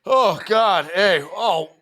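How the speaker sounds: high, loud ungrateful whining; whiny Clip. Acted. Clip greets the friends who saved him, whiny and high. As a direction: whine at your rescuers, pitched well up. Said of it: "And Clip's like".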